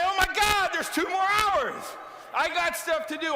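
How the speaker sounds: thrilled there's time left to get things done; eager excitement. Shown as a demonstration. Speaker showing employees the upbeat answer, eager and excited. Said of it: "Why don't you say".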